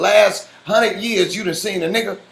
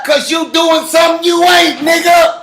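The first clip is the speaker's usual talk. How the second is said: real outburst, pitched way up; angry shout; 8 semitones higher, 3 dB louder than usual. Real, not acted. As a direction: shout it high, cutting in on the argument. Angry shout in heated argument.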